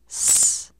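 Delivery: s hissed alone, unvoiced; just the s, no vowel; isolated s sound. Shown as a demonstration. Teacher isolating the s sound. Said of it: "Repeat after me".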